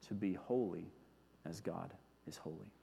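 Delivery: low and fading to very soft; reverent, softened conclusion; solemn hush. Real, not acted. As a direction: let the closing words settle quietly. Solemn close of the point.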